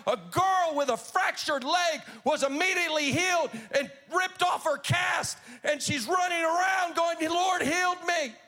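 loud, rising revival preaching; full-force preaching. Acted. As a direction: build the crowd up in a sermon.

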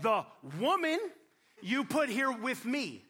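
slow, pointed blame-shifting; sulky husband passing the buck; blaming Adam. Acted. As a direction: drag it out, pinning it on her. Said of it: "He wouldn't even use her name".

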